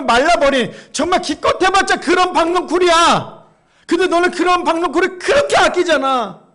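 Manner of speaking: loud, high, hammering reproach; forceful rebuke. Acted. Preacher voices God rebuking Jonah over the vine, forceful reproach.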